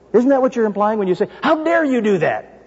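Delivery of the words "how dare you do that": pitched much higher, with the stress on "dare"; a hint of a scolding sibling; indignant scolding. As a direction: lift "How dare you" up in indignation.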